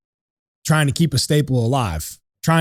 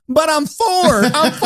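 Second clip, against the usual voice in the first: put-on much higher, like a small boy insisting; child's voice; 13 semitones higher, 4 dB louder, slower than usual. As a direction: play the little son protesting, much higher. Sal voices his little son.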